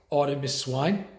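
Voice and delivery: usual speaking voice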